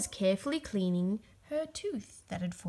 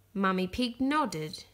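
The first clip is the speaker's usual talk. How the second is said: plain reading voice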